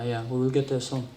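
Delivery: ordinary delivery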